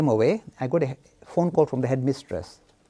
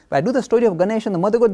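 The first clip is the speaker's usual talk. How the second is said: ordinary talk, nothing marked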